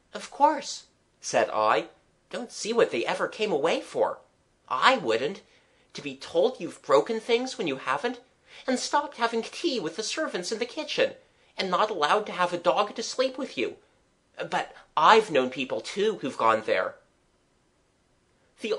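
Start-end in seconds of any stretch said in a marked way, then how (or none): 0.1-0.8 put-on high, quick-to-agree child's voice; matter-of-fact child. Child agreeing with the artist, matter-of-fact. 2.3-17.0 put-on higher, lilting child's voice; aggrieved child. Child complaining of rules at home, mildly indignant.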